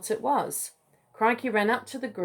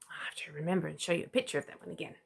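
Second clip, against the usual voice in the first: whispered aside; 3 semitones lower, 9 dB quieter, much faster than usual. Real, not acted. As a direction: breathe it fast, barely voiced.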